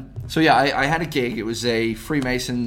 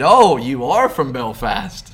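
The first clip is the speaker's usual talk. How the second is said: slow, louder punchline with a drawn-out "Oh"; mock realization; 6 dB louder, slower than usual. Performer retells his comeback to a Belfast heckler, mock realization. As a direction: let it dawn on you, drawn out and knowing.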